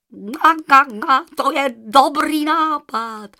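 goose voice. Acted. The goose agrees to give a feather, loud honking voice. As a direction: honk out the agreement. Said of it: "odpověděla husa".

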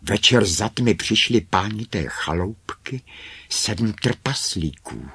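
plain reading voice

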